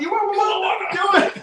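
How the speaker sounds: very high, slow drawn-out mocking call; mock yell. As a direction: stretch it out, squealing the joke. Mocking the old bar owner.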